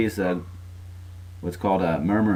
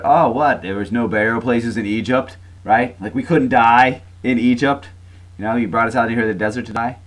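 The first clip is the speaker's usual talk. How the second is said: loud, higher-pitched mock indignation; sarcastic complaint; 3 semitones higher, 5 dB louder than usual. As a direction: imitate sarcastic grumblers, punching it out. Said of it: "I love the, uh, sarcasm here".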